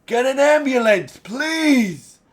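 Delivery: screamed mock plea, very high; desperate plea. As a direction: beg for an ambulance in sheer panic. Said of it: "you can hear the".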